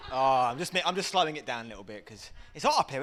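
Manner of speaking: ordinary talk, nothing marked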